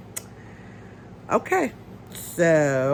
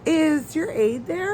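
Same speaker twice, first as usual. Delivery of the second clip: mimicking coordinator; 7 semitones higher, 3 dB quieter, much slower than usual. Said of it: "and she's like".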